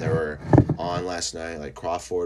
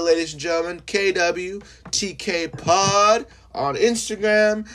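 much higher, louder, stretched-out announcer call; announcer hype; 9 semitones higher, 6 dB louder than usual. Acted. Host pushing the shirts to listeners, big announcer voice. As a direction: belt it like a ringside announcer.

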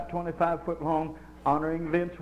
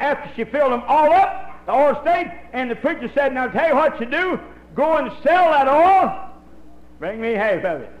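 high, loud preacher's cadence; preaching at full force; 9 semitones higher, 8 dB louder than usual. Acted. Preacher telling the congregation the oil miracle, loud and rising. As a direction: drive the story home from the pulpit. Preaching, retelling Elisha story.

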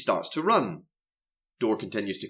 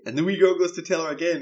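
ordinary delivery

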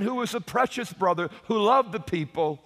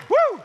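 a sudden, much higher, louder whoop; excited whoop; 6 dB louder than usual. Real, not acted. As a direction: let out a joyful woo. Exclaims after preaching line.

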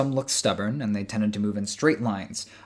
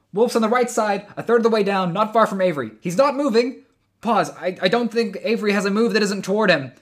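over a radio; 10 semitones higher, 6 dB louder than usual. Acted. Jude reporting over radio.

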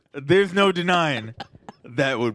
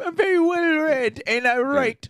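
high, smug put-on voice; mock J K Rowling; 9 semitones higher, 4 dB louder than usual. Friends mocking the author, high smug voice. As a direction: play the author as a sneering bigot.

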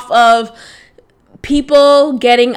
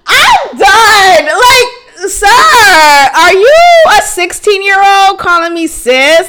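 much higher and louder, laughing through it; exasperated laughing outburst; 6 semitones higher, 4 dB louder, slower than usual. Real, not acted. Venting to listeners about the boy calling her sis, laughing shout. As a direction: blurt it out in disbelief, half laughing.

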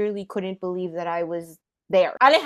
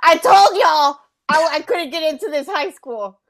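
put-on upset teen; 7 semitones higher, 7 dB louder than usual. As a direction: replay the moment you gave up, voice pitched up and loud. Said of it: "I was so sad".

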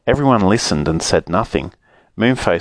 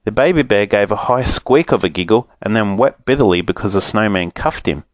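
plain reading voice

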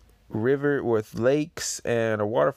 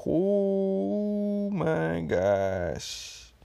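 awed; 9 semitones higher, much slower than usual. Real, not acted. Reacting to the double spread.

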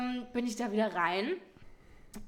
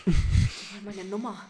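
excited; 6 dB louder than usual. Retold or imitated. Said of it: "Und ich so".

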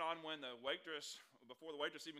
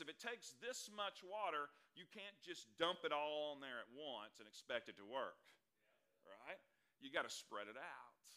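ordinary talk, nothing marked

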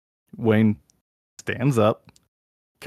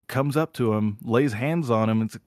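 usual speaking voice

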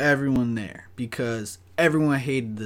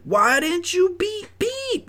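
very high, hammed-up squawk; soaring mock-indignant question; retold outraged demand; 5 dB louder, slower than usual. Dog walker confronting coworker over no beep, shrieky mock outrage. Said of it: "I was like".

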